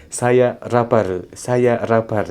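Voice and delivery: put-on slow Japanese-accented "Saya rapar", R in place of L; a light Japanese accent, L turned to R. Teacher showing students a light Japanese accent. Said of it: "itu orang Jepang".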